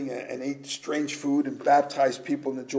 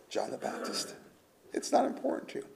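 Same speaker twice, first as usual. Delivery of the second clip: whispered; 8 semitones higher, 12 dB quieter than usual. Real, not acted. Hushed sermon point.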